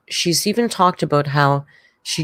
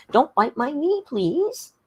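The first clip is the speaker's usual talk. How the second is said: high-pitched pleading with a pet; pet-talk plea; 11 semitones higher, slower than usual. Real, not acted. Streamer interrupts herself to plead with her biting pet, high pet-talk voice.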